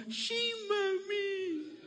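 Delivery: child mock-whimpering to stay home from school; fake-sick child. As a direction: play the sickly kid fooling mom. Sick child answers mother.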